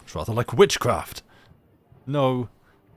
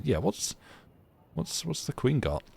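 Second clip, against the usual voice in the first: normal talking voice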